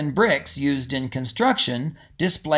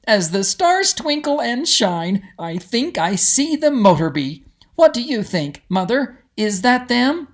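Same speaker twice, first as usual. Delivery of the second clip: put-on higher, excited girl voice; eager young Molly; 8 semitones higher than usual. Molly asking her mother, excited and eager. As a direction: bubble over with hope.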